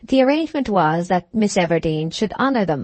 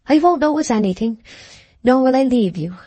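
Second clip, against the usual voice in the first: lightly voiced sob; 6 semitones higher than usual. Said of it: "sobbed Liddy impulsively".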